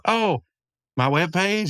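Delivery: a hint of Margaret caught off guard; surprised Margaret. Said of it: "she goes".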